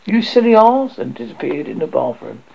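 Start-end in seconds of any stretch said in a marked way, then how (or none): 0.1-1.0 high, loud fairy insult; insulting reply. A narrator's reading. Tink answering Peter back, scornful outburst. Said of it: "Tink replied in those words".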